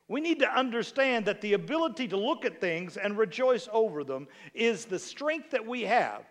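ordinary talk

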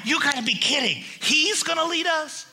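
incredulous, pitch rising high; can't believe he's in charge; high scoffing disbelief. Retold or imitated. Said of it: "now you're going".

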